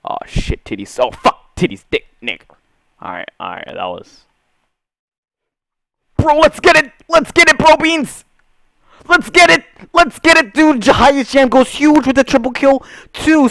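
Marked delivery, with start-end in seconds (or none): 6.2-13.5 very high, loud hype yelling; hyped gamer bro. Real, not acted. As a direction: scream it like a streak hype. Hyped after kills.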